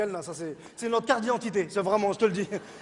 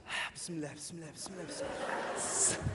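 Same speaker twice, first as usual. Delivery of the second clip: muttered bismillah; 8 semitones lower, 15 dB quieter than usual. Retold or imitated. Father angry, muttering.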